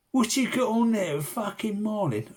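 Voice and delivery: loud, high call from far off; calling across the lake. Retold or imitated. Bloke across the lake calls out, mock shout. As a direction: shout it over the water, half mocking.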